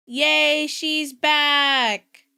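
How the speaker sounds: a bright whoop of relief; excited cheer. Real, not acted. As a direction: cheer that the stream is back. Stream reconnected, cheering.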